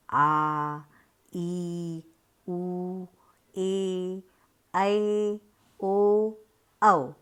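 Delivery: each vowel held a little longer, one by one; slow, deliberate recitation of long vowels; drawn-out long vowels. Shown as a demonstration. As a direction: stretch every vowel like a teacher demonstrating. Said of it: "उन्हें हम दीर्घ स्वर कहते हैं".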